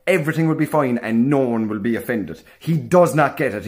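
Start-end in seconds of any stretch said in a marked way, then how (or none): none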